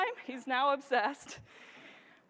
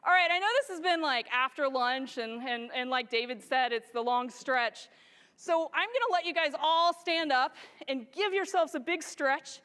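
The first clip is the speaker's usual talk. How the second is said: usual speaking voice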